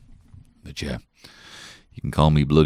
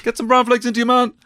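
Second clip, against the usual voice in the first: high, loud, hearty put-on dad; 8 dB louder than usual. Dad coaxing his sleepy son to breakfast, high hearty put-on voice. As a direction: boom it like a jolly cartoon dad. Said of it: "doing the dad voice".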